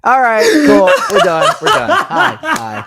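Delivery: loud flustered laughter; laughing. Real, not acted. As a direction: laugh through the words, embarrassed. Laughs off embarrassing ASMR bit.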